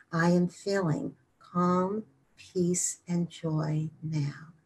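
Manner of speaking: soft, slow calming mantra; hushed, drawn-out meditative phrase; slow repetition. Shown as a demonstration. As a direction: breathe the affirmation out gently, fading at the end. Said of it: "And then slowly repeat".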